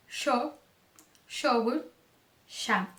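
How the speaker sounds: yo, yogurt, ya beginning with "sh", as in Argentina; Rioplatense SH. Shown as a demonstration. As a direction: turn the Y of yo, yogurt, ya into "sh". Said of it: "pode ser pronunciado como X". (Y com som de X em yo, yogurt, ya)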